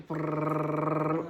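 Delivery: rolled brrr imitating a drum roll; sustained trilled suspense noise. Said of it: "Drum roll".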